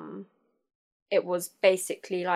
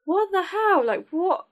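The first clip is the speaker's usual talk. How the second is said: much higher, outraged what the hell; replaying the shock of the insult, pitched up; stunned disbelief; 11 semitones higher, 4 dB louder than usual. Retelling her reaction to insults.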